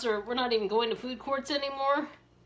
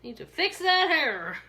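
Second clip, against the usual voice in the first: sassy honey; 6 semitones higher, 3 dB louder than usual. Retold or imitated. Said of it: "I'm like".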